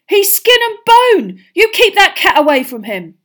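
loud, high-pitched outrage; furious. A narrator's reading. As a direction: shout a furious warning. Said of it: "he said furiously".

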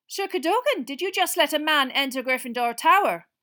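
loud, high-pitched interrogation; stern demand. Acted. Professor McGonagall questioning Sir Cadogan, stern. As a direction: demand an answer at full volume.

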